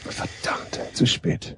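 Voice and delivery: mock dramatic whisper. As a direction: breathe it out like a secret lament. Echoing the curse as a joke.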